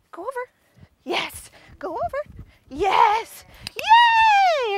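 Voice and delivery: high, bright dog commands; excited cheering. Real, not acted.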